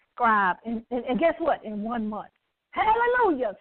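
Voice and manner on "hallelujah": joyful hallelujah. Real, not acted. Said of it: "I gotta put a hallelujah right there".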